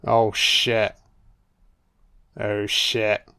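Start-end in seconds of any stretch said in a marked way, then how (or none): none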